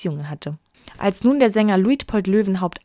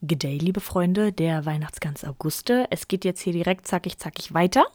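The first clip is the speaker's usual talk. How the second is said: usual speaking voice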